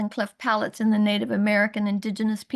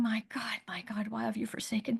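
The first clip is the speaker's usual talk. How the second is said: softer, whispered plea to God; whispered prayer; 10 dB quieter than usual. Retold or imitated. Mother on her knees praying to God, whispered. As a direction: pray it under your breath, desperate. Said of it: "on my knees going".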